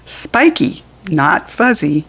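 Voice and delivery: ordinary delivery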